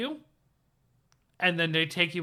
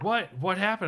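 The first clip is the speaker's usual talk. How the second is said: pitched-up puzzled questions; confused surprise; 6 semitones higher than usual. Retold or imitated. Friend asking what happened in the game, puzzled and high. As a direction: ask like you just missed something. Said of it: "and then he was like".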